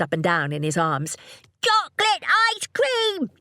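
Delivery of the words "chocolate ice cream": put-on high, drawn-out little boy's yell; excited yell. A narrator's reading. Son bouncing in his father's arms, excited yell. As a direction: yell it like an overjoyed child. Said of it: "he yelled".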